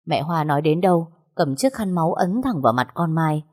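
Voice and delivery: usual speaking voice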